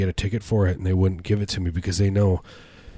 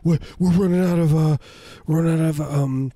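higher, anxious panicky dad; worried parent voice; 7 semitones higher than usual. Pretend. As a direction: fret about running out of medicine.

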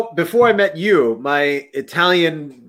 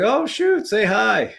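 much higher, light retold exclamation; high-pitched quoted greeting; parents' surprised reply; 5 semitones higher, much slower than usual. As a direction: imitate parents, pitched up and pleased to hear. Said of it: "they were like".

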